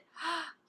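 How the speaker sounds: sharp, high little gasp; quick awed intake of breath; gasp of delight. Real, not acted. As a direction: catch your breath at how pretty it is. Awed by a swatched shade.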